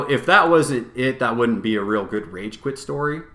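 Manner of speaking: ordinary delivery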